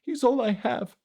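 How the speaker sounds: high, breaking tearful cry; bursting into tears. Acted. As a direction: let the tears break through the words. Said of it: "Elisa burst into tears".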